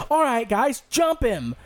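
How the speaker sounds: mock Corey. Corey calling friends to attack.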